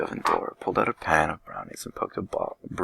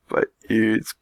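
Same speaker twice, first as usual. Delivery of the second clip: high, slow, louder sniveling wail; snuffling; 5 semitones higher, 7 dB louder, much slower than usual. Acted. Melody to her family, snuffling over her ear. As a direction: drag it out like a self-pitying child. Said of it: "Melody snuffed".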